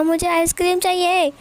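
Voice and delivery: put-on high, loud child's whine; whiny little girl. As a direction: demand it back like a cranky toddler. Child demands ice cream again.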